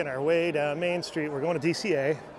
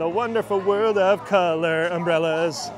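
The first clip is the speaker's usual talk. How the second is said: announcer voice; 2 semitones higher, 6 dB louder than usual. Pretend. Echoes friend in announcer voice.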